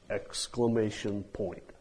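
usual speaking voice

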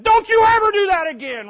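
loud, high angry scold. Pretend. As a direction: snap at the child. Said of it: "and I said".

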